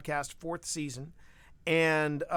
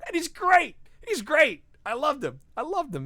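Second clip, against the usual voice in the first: excited; 10 semitones higher, 7 dB louder than usual. Real, not acted. Gushing about Jon Bernthal.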